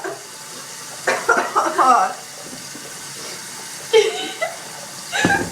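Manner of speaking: coughing through laughter; hacking cough breaking into laughs; coughing fit. Real, not acted. Coughing and laughing after cinnamon.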